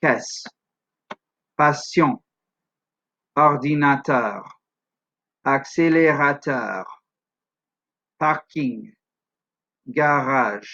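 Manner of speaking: "Caisse, patient, ordinateur, accélérateur, parking, garage" in French, syllables even; measured French words, every syllable equally weighted; French pronunciation. Shown as a demonstration. Teacher translating English words to French, evenly stressed French syllables. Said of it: "you get the following".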